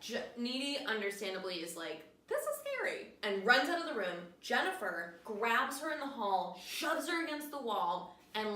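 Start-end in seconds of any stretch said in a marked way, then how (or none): 2.3-3.1 high, squeaky mock fear; hammed-up panic, much higher; mock frightened. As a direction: squeal it like a scared teen. Needy fleeing the room.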